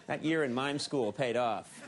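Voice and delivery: ordinary delivery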